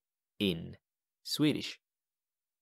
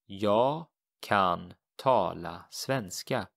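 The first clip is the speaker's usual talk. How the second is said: Jag kan tala svenska, slow and deliberate; teacher modelling Swedish slowly; slow model sentence; slower than usual. Shown as a demonstration. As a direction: spell out each word slowly for the learner. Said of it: "So how would you say this?".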